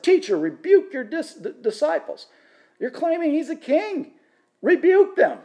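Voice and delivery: high-pitched, affronted demand; indignant Pharisees. Acted. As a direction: voice an objector calling from the crowd, pitched well up.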